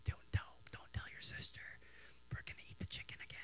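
mom's hushed secret; conspiratorial whisper. Retold or imitated. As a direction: whisper it so sister won't hear. Mom secretly plotting with son.

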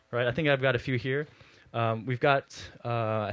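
ordinary delivery

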